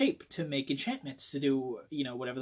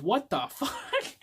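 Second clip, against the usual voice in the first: laughing disbelief; 10 semitones higher, slower than usual. Real, not acted. Laughing at a gory spoiler.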